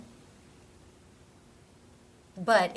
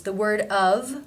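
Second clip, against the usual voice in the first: usual speaking voice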